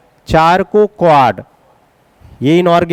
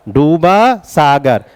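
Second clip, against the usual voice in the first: slow mnemonic recital; 2 semitones lower, much slower than usual. Shown as a demonstration. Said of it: "नाम कैसे याद रखोगे?".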